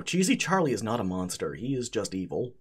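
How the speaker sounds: ordinary talk, nothing marked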